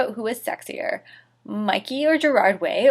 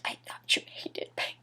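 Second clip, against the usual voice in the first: whisper; 15 dB quieter than usual. Real, not acted. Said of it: "whispered sarcastic aside".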